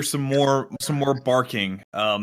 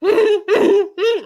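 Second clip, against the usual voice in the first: high squeaky yap; falsetto bark; 8 dB louder than usual. Shown as a demonstration. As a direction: yelp like a small dog in falsetto. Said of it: "like, a falsetto bark".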